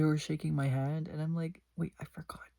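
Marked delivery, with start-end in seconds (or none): 1.8-2.5 urgent unvoiced whisper. Whispering to Goose that she forgot the mom's name. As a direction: hiss it secretly to a friend. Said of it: "I whispered to Goose".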